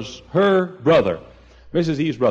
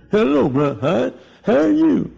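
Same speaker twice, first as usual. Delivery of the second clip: imitating Urban's speech; 8 semitones higher, 3 dB louder than usual. Pastor imitating the afflicted choir member greeting him, a higher strained voice. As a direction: mimic the man's impaired voice, pitched up. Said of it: "that's the way he talked".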